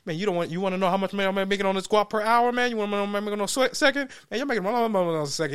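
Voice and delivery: rapid-fire pitch, words tumbling out; fast-talking hustler. Retold or imitated. Mocking the Bitcoin guy, rapid-fire and fast. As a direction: rattle it off like a hustler who won't stop. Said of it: "He's just talking so fast".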